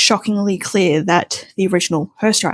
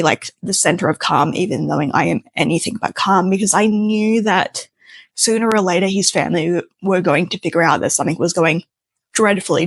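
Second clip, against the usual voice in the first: normal talking voice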